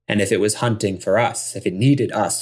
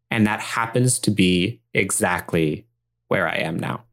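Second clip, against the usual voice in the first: ordinary talk, nothing marked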